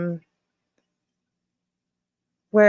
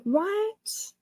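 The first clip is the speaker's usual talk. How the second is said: shocked; 13 semitones higher, 7 dB quieter than usual. Real, not acted. Shocked by news.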